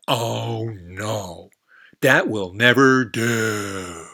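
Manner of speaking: loud, drawn-out frog voice dismissing the idea; loud frog refusal. Acted. Frog turning down the goat, loud and slow. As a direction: stretch out never do like a snooty frog.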